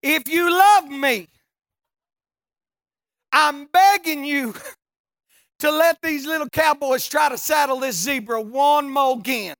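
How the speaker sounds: high, loud pleading prayer with a laugh; shouted, laughing plea to heaven; loud begging prayer. Retold or imitated. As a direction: beg God at full volume. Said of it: "The second time I said".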